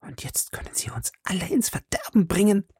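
urgent, high whisper; half aloud. A narrator's reading. Madame Bonacieux warning that he can ruin them, hushed. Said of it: "sagte Madame Bonacieux halblaut".

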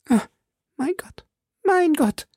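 very high, tearful wail, starting faint; whimpering. A narrator's reading. Milady, facing drowning, whimpers in a very high voice. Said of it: "wimmerte sie".

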